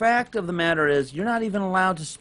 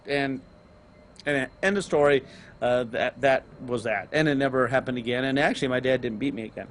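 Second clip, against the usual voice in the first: normal talking voice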